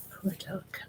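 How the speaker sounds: ordinary delivery, nothing marked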